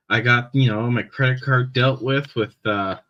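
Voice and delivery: ordinary talk, nothing marked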